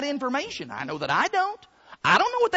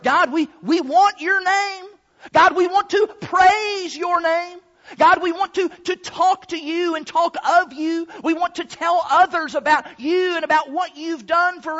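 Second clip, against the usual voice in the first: raised, louder voice imploring God; earnest plea; 6 semitones higher, 4 dB louder than usual. Acted. People down on earth pleading with God for His name, earnest plea. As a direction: call up to heaven, high and insistent.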